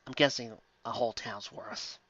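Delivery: ordinary talk, nothing marked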